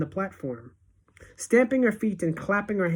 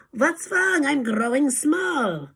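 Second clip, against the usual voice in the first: mock frightened cry; 9 semitones higher, slower than usual. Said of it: "cries out".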